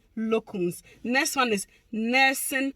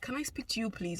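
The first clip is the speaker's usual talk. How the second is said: much softer, timid ask; imitating shy request; 11 dB quieter than usual. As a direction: ask timidly, almost hushed. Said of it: "was like".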